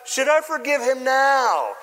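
stagey king seeking a verdict; king addressing crowd. Acted. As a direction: declaim it to the whole audience. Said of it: "And the king turns to the crowd".

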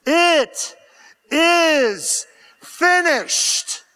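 high, loud, drawn-out proclamation; proclaimed declaration. Acted. Preacher voicing the church's declaration, loud slow proclamation. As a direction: ring out each word slowly and triumphantly. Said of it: "here's what we will say".

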